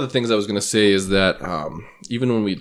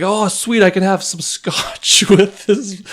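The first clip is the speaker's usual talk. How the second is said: mock excited friend; 11 semitones higher, 5 dB louder, much slower than usual. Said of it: "He's like".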